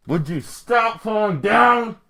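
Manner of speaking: high, loud, drawn-out shout; exasperated yell. Real, not acted. Vlogger scolding something that keeps falling, exasperated yell. Off-script outburst mid-quote.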